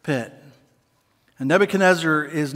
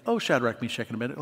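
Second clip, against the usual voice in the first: mock formal greeting; 7 semitones lower, 9 dB quieter, much faster than usual. Shown as a demonstration. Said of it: "This makes me giggle".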